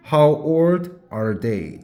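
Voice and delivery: very slow, clear teacher model; slow classroom demonstration; slow model phrase. Shown as a demonstration. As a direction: stretch each word for students. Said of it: "영어로 어떻게?".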